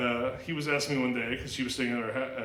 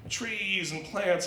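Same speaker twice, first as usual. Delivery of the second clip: put-on slow childish answer; 7 semitones higher, much slower than usual. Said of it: "I'm like, like".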